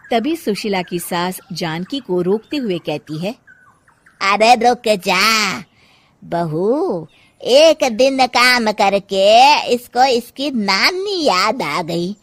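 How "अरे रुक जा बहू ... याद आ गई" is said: loud, slow taunting; mock her softness with disdain; scornful mother-in-law. Mother-in-law stops Janki scornfully. (तिरस्कार भरी सास की आवाज़)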